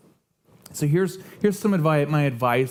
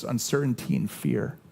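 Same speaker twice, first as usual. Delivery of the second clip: usual speaking voice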